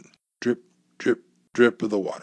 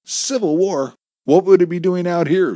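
higher-pitched, incredulous questioning; puzzled question; 9 semitones higher, 6 dB louder than usual. A narrator's reading. Captive digger asks about Civil War gold, puzzled. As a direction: question it in a raised voice. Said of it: "Jerry asked".

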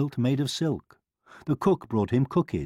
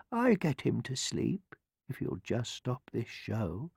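soft-voiced old woman; quiet, confident old petitioner; humble old woman; 8 dB quieter than usual. A narrator's reading. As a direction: offer help gently and quietly. Old woman softly offers her help.

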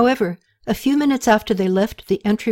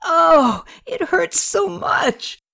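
high, slow pained cry; 10 semitones higher, much slower than usual. A narrator's reading. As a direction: Katie cries out as someone presses the wound. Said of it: "cried out".

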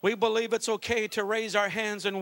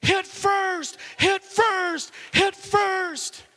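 high, loud repeated yell from the bleachers; 12 semitones higher, 8 dB louder, much slower than usual. Retold or imitated. Dad yelling at his daughter from the bleachers, high and loud. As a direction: bellow it like a fired-up dad cheering. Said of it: "I start yelling".

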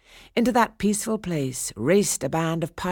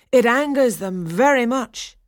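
stone nymph warning; 8 semitones higher, 8 dB louder than usual. A narrator's reading.

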